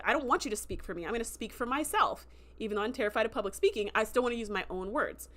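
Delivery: ordinary talk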